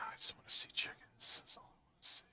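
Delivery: soft unvoiced whisper. Real, not acted. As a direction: breathe the words under your breath. Muttering to himself about chickens.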